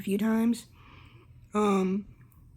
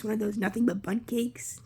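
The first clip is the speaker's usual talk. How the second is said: usual speaking voice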